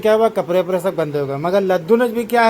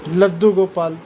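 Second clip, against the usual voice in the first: usual speaking voice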